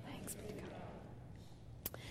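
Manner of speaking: hushed whispered thanks be to God; murmured response. Real, not acted. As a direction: breathe it softly like a congregation's reply. Congregational liturgical response.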